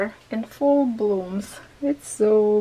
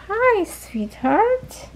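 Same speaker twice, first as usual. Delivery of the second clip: drawn-out high-pitched sweet greeting; slow high coo; 10 semitones higher, much slower than usual. Real, not acted. Owner greeting a puppy in a slow high coo. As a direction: stretch it out sweetly, much higher.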